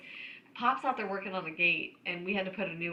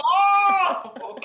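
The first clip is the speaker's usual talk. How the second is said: loud high surprised oh; loud mock realization; sudden realization; 10 semitones higher, 10 dB louder than usual. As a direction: it finally clicks. Mom's realization.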